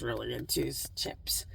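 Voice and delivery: breathy whispered aside. Real, not acted. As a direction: drop to a whisper.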